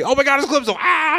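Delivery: high mock scream; mock panic. Fan voicing a panicked hero spotting Eclipso, high mock shriek. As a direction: squeal in fake terror at Eclipso.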